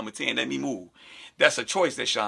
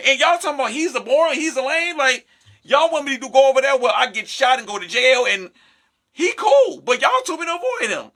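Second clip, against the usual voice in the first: put-on high, loud voice of a happy girlfriend; high girly mock voice; 10 semitones higher, 5 dB louder than usual. Girl taunting her friends, high and loud with joy. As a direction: gush like a girl who found a good man.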